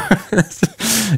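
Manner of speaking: a high, airy burst of laughter. Real, not acted. Laughing after the story.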